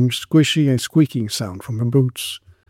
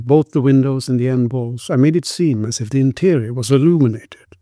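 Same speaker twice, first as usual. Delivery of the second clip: usual speaking voice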